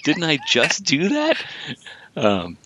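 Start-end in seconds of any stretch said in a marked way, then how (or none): 0.0-1.4 high, drawn-out mock exasperation; incredulous reaction. Imitating the doctor's reaction to Kirk, incredulous. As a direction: stretch it out in high-pitched disbelief. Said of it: "His reaction is like".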